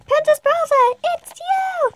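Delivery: very high, loud squeal; excited girls. Acted. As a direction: squeal with delight. Girls excited to see princess.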